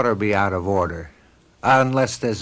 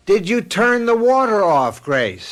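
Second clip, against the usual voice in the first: raised, higher call across the house; 8 semitones higher, slower than usual. Acted. Husband calling to his wife again, raised and higher.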